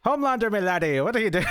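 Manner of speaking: a terrible mock Irish voice; a mock Irish scolding aimed at Homelander; bad Irish accent. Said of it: "That was terrible".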